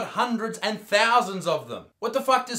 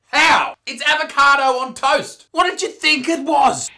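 loud, higher disbelief; incredulous rant; 5 semitones higher, 5 dB louder than usual. Acted. Reacting to the video host, loud incredulous rant. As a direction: throw the question at her, exasperated. Ranting at the avocado toast clip.

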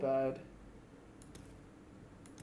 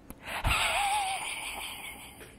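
high breathy squeaking weasel cackle; weasel laugh. Pretend. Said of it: "That's my weasel impression".

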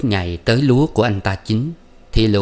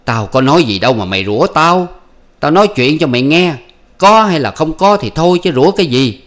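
louder, raised protest; husband protesting to his wife, louder; defensive husband; 7 semitones higher, 6 dB louder than usual. Acted. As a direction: push back at her cursing, louder.

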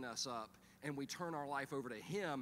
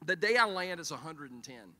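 normal talking voice

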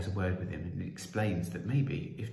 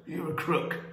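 raised-pitch heckle from a crowd; angry shout; 9 semitones higher, 4 dB louder than usual. Acted. Man in the mob heckling the Prime Minister, shouted. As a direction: shout the accusation out. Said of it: "another man shouted".

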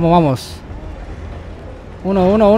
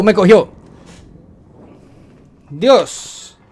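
ordinary delivery

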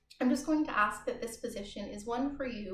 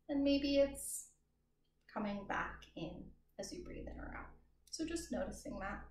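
guided meditation. Acted. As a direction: ease them into noticing their breath. Leads a guided breath meditation.

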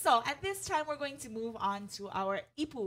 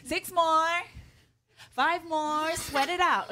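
louder, higher peppy workout coach; aerobics instructor; 5 semitones higher, 5 dB louder, slower than usual. Pretend. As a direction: pump up the class, mock fitness coach. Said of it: "I sound like an aerobics instructor".

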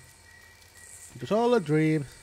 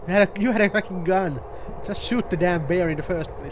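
loud, higher-pitched annoyed rant; indignant gamer complaint; exasperated outburst; 4 semitones higher, 3 dB louder than usual. Real, not acted. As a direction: swear at your companion in real exasperation. Exasperated at game companion.

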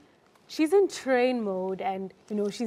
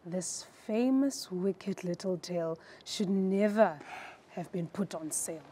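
very slow, fading soft read; slug version; 3 semitones lower, 6 dB quieter, slower than usual. Shown as a demonstration. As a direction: drag every word like a slug. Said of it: "a slug version".

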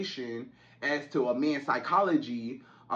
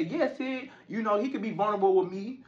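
lightly voiced woman, higher and quick; breezy mock assurance from a girlfriend; imitating a woman; 5 semitones higher than usual. Woman assuring Sid her man can be vulnerable, lightly imitated. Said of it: "you may say".

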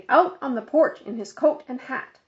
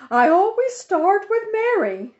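high, loud bossy lady's voice; fussy Mrs Armstrong; 11 semitones higher, 7 dB louder than usual. A narrator's reading. Busybody on the phone lecturing Mother, high and loud. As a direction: play the busybody organizer insisting.

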